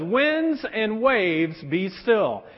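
slow, drawn-out command to the storm; calm command. Retold or imitated. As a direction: say it slowly, stilling the sea.

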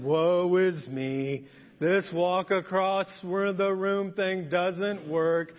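mock dejected, very slow drawl; Eeyore. Walking back rejected with popcorn, mock mournful Eeyore. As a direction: drag it out in hammed-up gloom. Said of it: "you know, Eeyore".

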